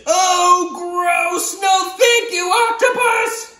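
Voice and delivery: very high, loud shouted protest; disgusted boy. Acted. Boy refusing the egg-salad bath, high shout. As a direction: yell it out grossed out. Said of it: "And the boy says".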